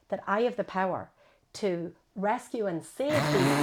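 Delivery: normal talking voice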